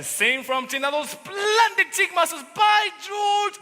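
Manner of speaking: enthusiastic exclamation. Acted. Salesman gushing to the mother, loud and rising. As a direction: build to a big by George.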